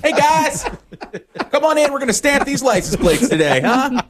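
high, hammed-up cheery convict; chipper prisoner. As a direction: play a relentlessly upbeat inmate podcaster. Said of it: "I quit being the chipper prisoner".

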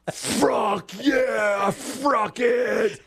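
high, drawn-out mock player yell; yelling to the dugout. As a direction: holler it like a pumped-up ballplayer, stretching the words. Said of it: "he's yelling to the dugout".

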